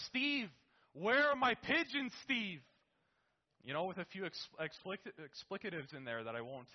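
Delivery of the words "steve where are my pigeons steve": much higher, louder, slow angry shout; angry yell. Retold or imitated. Dad furious at Steve over the pigeons, angry shout. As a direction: bellow his name across the yard.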